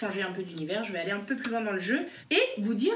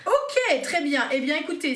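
excited; 7 semitones higher, 5 dB louder than usual. Real, not acted. Host excitedly wraps up the round.

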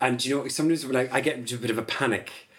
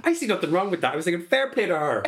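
mock indignant defense; 6 semitones higher than usual. Defending herself to partner.

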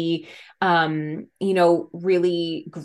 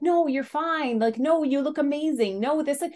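higher, brushing-off "no, you're fine"; a parent quickly brushing off a child's worry; dismissive reassurance; 7 semitones higher than usual. Retold or imitated. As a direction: wave the worry away lightly. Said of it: "instead of being like".

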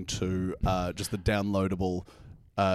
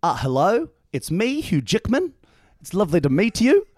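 much higher, louder hammed-up Aussie star; Hugh Jackman impression; 12 semitones higher, 7 dB louder than usual. Host asks for the celebrity, hammed-up Hugh Jackman voice. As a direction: beam like a cheesy celebrity. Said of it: "an impression of Hugh Jackman".

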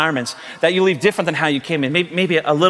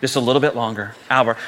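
calm dad; 7 semitones lower than usual. Retold or imitated. Dad soothing himself while his boy screams, calmly. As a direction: keep it unruffled, low and measured, each phrase gently falling. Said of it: "the dad's just calmly".

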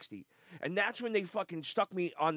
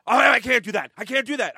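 boss refusing, louder; 5 semitones higher, 9 dB louder than usual. Retold or imitated. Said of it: "retold boss refusing".

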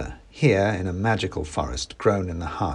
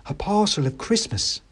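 mouse seller; 10 semitones higher than usual. Acted. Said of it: "said the mouse".

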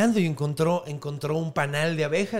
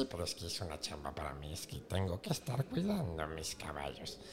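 put-on low whispered old crone; old Baba Yaga whisper; 11 semitones lower, 12 dB quieter than usual. Baba Yaga complaining about her horses, whispered old crone. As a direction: grumble like a tired old witch.

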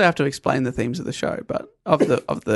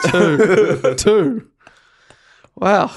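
ordinary talk, nothing marked

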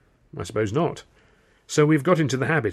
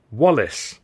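sharp, louder, falling emphasis; sharp correction; 5 dB louder than usual. A narrator's reading. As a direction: put him right, firmly and sharply. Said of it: "Tilly said sharply".

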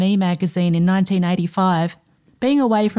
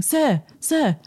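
a hint of an agitated Danish hotel clerk, higher; light Danish accent, urgent and raised in pitch; mildly flustered Danish call for attention; 7 semitones higher than usual. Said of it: "in an agitated Danish accent".

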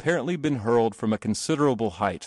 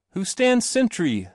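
high, slow challenge call; 9 semitones higher, 3 dB louder than usual. A narrator's reading. Boy calling to an unseen sentry, calling out. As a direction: call it slowly to the distant gate.

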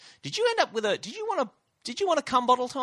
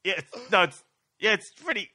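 embarrassed; 8 semitones higher, 4 dB louder than usual. Retold or imitated. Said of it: "And I was like".

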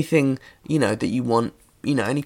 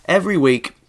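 louder, bright announcer opener; energised; 4 semitones higher, 5 dB louder than usual. Shown as a demonstration. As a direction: sound full of energy. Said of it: "I'm gonna sound, uh, energised by this".

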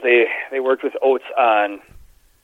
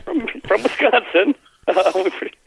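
mock incredulous; 10 semitones higher than usual. Teasing player who feigns Russian.

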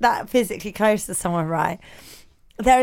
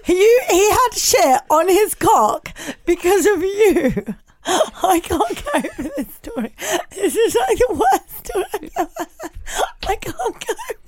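high, laughing through tears; shrieking with laughter; crying with laughter; 7 semitones higher, 3 dB louder than usual. Real, not acted. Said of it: "Oh, my God, I'm crying".

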